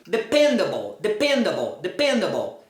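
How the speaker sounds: "dependable" stressed on PEN; weak falling -able in "dependable"; correct pronunciation. Shown as a demonstration. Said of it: "nunca vai ser a sílaba tônica".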